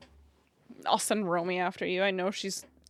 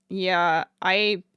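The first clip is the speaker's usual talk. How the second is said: usual speaking voice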